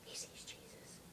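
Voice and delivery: breathy whispered aside. Real, not acted. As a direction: whisper it under your breath. Aside about the dog.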